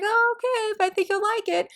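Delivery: high, light friend's voice; imitating a friend. Friend Meg nudging the reader, high and light. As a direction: lightly voice Meg's gentle reply.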